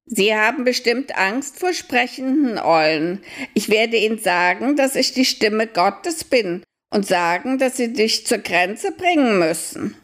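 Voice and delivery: high owl voice, scheming; a high owl, plotting calmly; sly owl. Acted. Owl plans to fool soldiers. (hohe, listige Eulenstimme)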